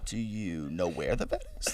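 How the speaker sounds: imitating a baked mom's calm. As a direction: ask idly, unbothered. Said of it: "it was so fucking calm".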